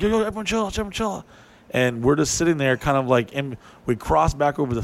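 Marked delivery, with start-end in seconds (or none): 0.0-1.2 high then hushed calming call; calming everyone. Retold or imitated. Teller telling the group to stay still, high then softer. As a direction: settle the group down. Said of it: "I'm like".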